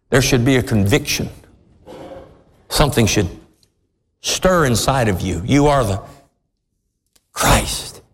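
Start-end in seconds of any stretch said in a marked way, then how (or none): none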